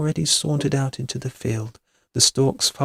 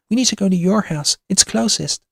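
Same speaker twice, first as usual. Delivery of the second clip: quick, high, louder urging; urgent alarm; 8 semitones higher, 5 dB louder than usual. A narrator's reading. Kitty wants shelter at Dorothy's house, urgent. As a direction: push her to get moving. Frightened by screams, urging to flee.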